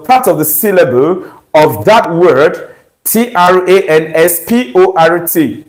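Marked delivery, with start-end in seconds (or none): none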